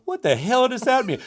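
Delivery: very high, incredulous outburst; high-pitched comic exasperation; mock bafflement. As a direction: squeal it in exaggerated disbelief. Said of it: "you don't wanna go".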